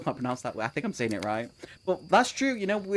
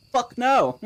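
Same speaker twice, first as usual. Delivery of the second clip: disgusted refusal; 7 semitones higher, 6 dB louder than usual. Retold or imitated. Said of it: "and I was like".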